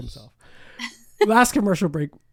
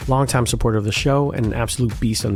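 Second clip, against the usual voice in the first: lower-pitched sponsor read over music; upbeat sponsor plug; 5 semitones lower, 4 dB louder than usual. Acted. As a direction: sell the coach like a radio spot. Host reads sponsor ad.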